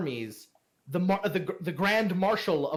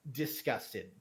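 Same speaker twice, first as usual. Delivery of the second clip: ordinary delivery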